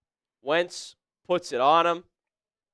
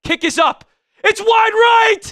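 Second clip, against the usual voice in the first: much higher, loud shouted call of the missed kick; screaming play-by-play; 11 semitones higher, 9 dB louder than usual. Real, not acted. Streamer yelling the missed kick to his chat, real joy. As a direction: scream it like the game just turned.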